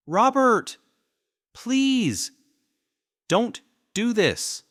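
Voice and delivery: high, loud desperate begging; wife screaming at husband to stop; pleading scream. A narrator's reading. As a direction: beg him to stop, frantic.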